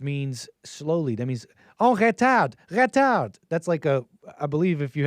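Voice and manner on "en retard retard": mock alarm, high and loud; yelling French cockpit warning. Plane controls telling the pilot to slow down, yelled French warning. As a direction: bark it like an automated French warning. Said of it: "it'll yell at you to slow down".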